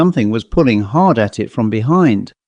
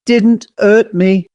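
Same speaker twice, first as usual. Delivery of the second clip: higher and slower, bitterly echoing; 9 semitones higher, slower than usual. A narrator's reading. Owl answering the apologetic fairy, bitter. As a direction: throw the words back with hurt scorn. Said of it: "said the owl bitterly".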